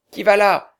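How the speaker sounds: loud, high sentry call; shouted challenge. Acted. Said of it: "Puis une voix cria".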